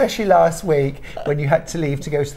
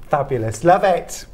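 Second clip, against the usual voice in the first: normal talking voice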